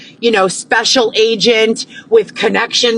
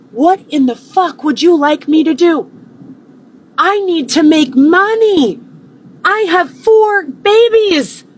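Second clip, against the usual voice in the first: high, raised voice near tears; distressed outburst; 6 semitones higher than usual. Real, not acted. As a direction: cry out in desperation.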